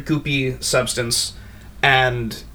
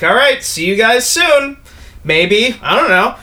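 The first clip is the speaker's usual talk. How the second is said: higher and louder, cheerful offhand voice; breezy farewell; 8 semitones higher, 7 dB louder than usual. Acted. Figure to the party, higher and louder breezy goodbye. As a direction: toss it off lightly, higher and louder. GM voicing Commendatore leaving.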